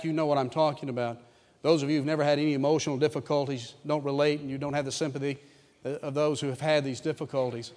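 ordinary talk, nothing marked

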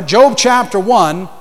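usual speaking voice

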